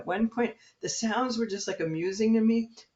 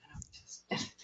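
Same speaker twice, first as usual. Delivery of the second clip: unvoiced whisper; 15 dB quieter than usual. Patient still whispering in the scanner room. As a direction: barely breathe it.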